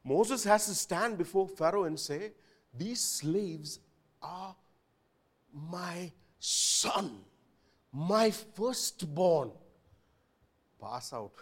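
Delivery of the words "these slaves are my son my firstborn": solemn. Acted. Moses declaring before Pharaoh.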